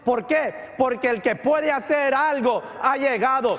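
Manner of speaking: announcing to the church that Jesus has arrived; fervent preaching. Acted. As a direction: proclaim it with triumphant conviction. Preaching at full force.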